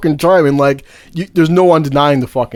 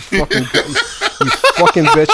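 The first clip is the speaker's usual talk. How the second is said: high, slow, cracking with laughter; laughing mock insult. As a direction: squeal it out while laughing at her.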